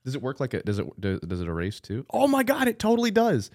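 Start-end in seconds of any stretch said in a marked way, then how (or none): 2.1-3.5 much higher, louder delight; genuinely amazed at the gadget. Real, not acted. Surprised at the erasing.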